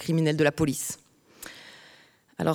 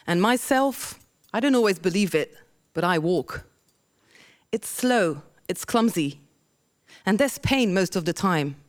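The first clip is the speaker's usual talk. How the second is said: poem reading; 5 semitones higher than usual. Acted. Reads her English poem.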